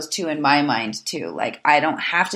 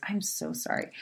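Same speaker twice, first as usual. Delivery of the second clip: whispered apology; 13 dB quieter than usual. Real, not acted. Tearful apology mid story.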